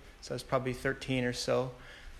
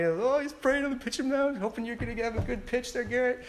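high, taunting schoolyard voice, much higher; sing-song mockery of a bully kid; mocking kid; 8 semitones higher than usual. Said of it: "another kid started making fun of me".

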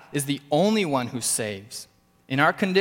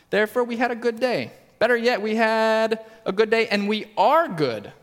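higher, self-satisfied mock boast; mock smugness; 8 semitones higher, 4 dB louder than usual. Preacher mocking the self-satisfied sinful heart, smug boast. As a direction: play the smug self-righteous heart, pleased with itself.

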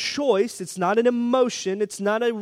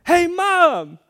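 put-on much higher, louder little girl calling Mom; child calling out; 9 semitones higher, 6 dB louder than usual. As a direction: call across the room like an excited kid. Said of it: "And then Emma goes".

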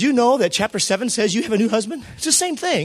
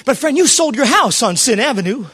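ordinary delivery

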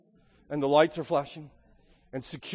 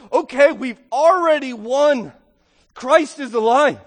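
triumphant declaration; 6 semitones higher, 8 dB louder, slower than usual. Acted. Believers declaring victory.

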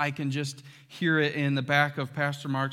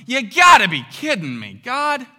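loud exasperated complaint, God higher on the end; exasperated outburst; 7 semitones higher, 12 dB louder than usual. Acted. Believer complaining to God in loud exasperated disbelief. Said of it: "you're familiar with this one".

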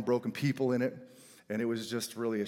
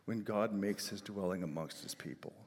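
ordinary delivery

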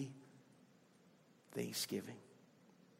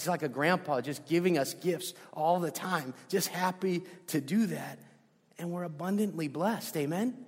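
ordinary talk

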